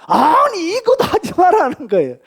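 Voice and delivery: high, loud insistence; emphatic preaching. Acted. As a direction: drive the point home hard.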